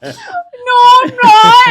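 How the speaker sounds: high wailing imitation of mom; imitated tearful protest, cracking up; mock crying denial. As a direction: wail it loudly in hammed-up tears. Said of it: "she's crying already".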